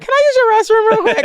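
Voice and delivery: very high, fast squealed request; eager pleading. Retold or imitated. Guest angling to see inside the mansion, high rushed squeal. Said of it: "everything in me wants to be like".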